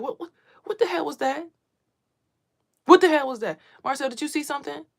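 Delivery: high frightened questions, loud in the middle; panicked mother grilling her child, pitched high; mother waking her son after a noise, panicked and high; panicked questioning. Retold or imitated. Wakes son after strange noise.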